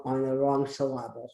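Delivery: syllable said as suh-LAB-ul, stress on the middle; playful mis-stressing of syllable as suh-LAH-bul; misplaced stress. Shown as a demonstration. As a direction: put the accent of syllable on LAB. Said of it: "it's just the wrong syllable".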